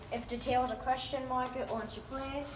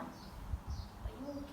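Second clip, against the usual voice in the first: whispered concern; 3 semitones higher, 13 dB quieter than usual. Real, not acted. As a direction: breathe it gently to a hurt pet. Cat bumped its head, soft concern.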